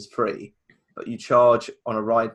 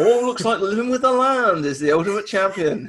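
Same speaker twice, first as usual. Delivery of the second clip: laughing; 9 semitones higher, 4 dB louder than usual. Acted. Mock announcement of winner.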